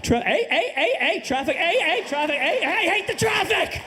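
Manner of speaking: building from mild to a high, yelping hey-hey outburst; Canadian Tourette's rant. Pretend. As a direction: escalate a polite Canadian into a sputtering rant about traffic. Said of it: "Canadian Tourette's rant".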